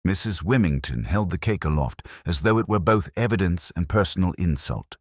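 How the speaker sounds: ordinary delivery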